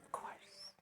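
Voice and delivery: quick whispered aside. Real, not acted. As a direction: breathe it out under your breath. Aside while asking the congregation.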